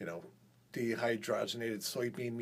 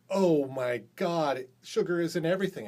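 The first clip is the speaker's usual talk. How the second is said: excited exclamation; 5 semitones higher, 7 dB louder than usual. Retold or imitated. Coworker quoted, exclaiming about sugar.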